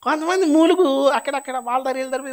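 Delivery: high, loud whiny voice; mock a moaning gossip; mocking complainer. Preacher mocks gossiping women. (ఎత్తైన గొంతుతో ఎగతాళిగా మూలుగుతున్నట్టు)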